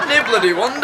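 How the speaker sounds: cracking up as she quotes it back; laughing echo. Retold or imitated. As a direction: laugh it out, delighted. Laughing, echoing the song's line.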